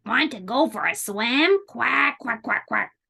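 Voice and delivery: quacky duck question. Acted. Duck invites spider for a swim, quacking voice. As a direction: talk like a duck, ending in quacks. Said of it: "called the duck".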